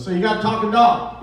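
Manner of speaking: a city guy all excited about the dog; eager buyer. Retold or imitated. Eager buyer asks farmer.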